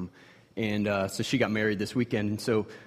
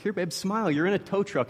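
coaxing cheer; 8 semitones higher than usual. Retold or imitated. Dad coaxing upset daughter.

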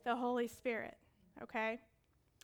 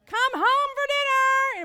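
sung-out high, loud call across the street; Mexican mother calling home; 13 semitones higher, 19 dB louder, much slower than usual. Retold or imitated. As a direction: call the child home for dinner, singing it out. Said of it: "'Cause they're like".

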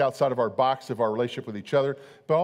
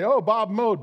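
mock neighbor; 6 semitones higher, 3 dB louder than usual. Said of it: "look at our lawn and say".

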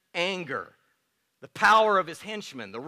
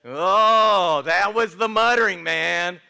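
much louder, delighted outburst; excited exclamation; 9 dB louder, slower than usual. Real, not acted. Pastor reacting to congregation's muttering, loud delight. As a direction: burst out laughing at the crowd noise.

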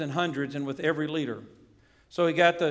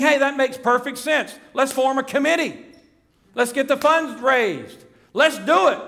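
higher, louder eager rush of ideas; eager churchgoers; 7 semitones higher, 5 dB louder than usual. Pretend. Preacher voicing eager church members, keen and loud. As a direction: pitch it like keen folk ready to act.

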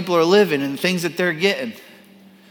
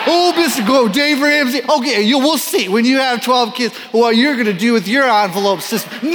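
mocking sneer; 5 semitones higher, 3 dB louder than usual. Mocking resentful married couples.

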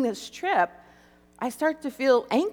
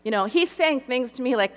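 usual speaking voice

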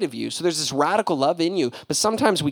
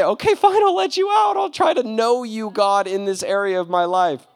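loud, high hammed-up surrender; yielding to God, loud and high; exasperated giving in; 6 semitones higher, 3 dB louder than usual. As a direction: throw up your hands and give in. Imagined giving in.

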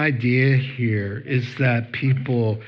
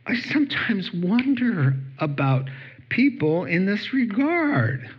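usual speaking voice

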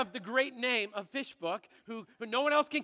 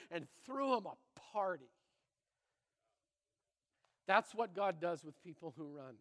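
softer, lower, earnest sermon voice; sincere quiet preaching; 6 semitones lower, 8 dB quieter than usual. Acted. Pastor telling the congregation of God's love, quiet earnest preaching. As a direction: bring the room down and speak from the heart.

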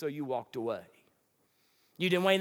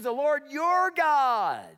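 "your" slow, loud and drawn out; stress on your; 8 semitones higher, 5 dB louder, much slower than usual. Shown as a demonstration. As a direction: lean on "your" to mark it isn't hers. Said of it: "She says".

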